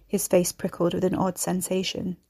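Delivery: ordinary delivery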